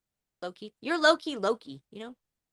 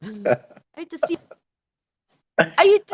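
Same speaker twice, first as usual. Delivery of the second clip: Count Dracula; 7 semitones higher, 9 dB louder than usual. Pretend. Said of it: "has to talk like this".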